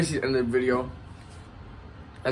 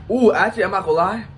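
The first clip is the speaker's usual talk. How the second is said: high loud surprised ooh; sudden surprise; 7 semitones higher, 6 dB louder than usual. Real, not acted. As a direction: caught off guard by the heat. Sudden heat hits.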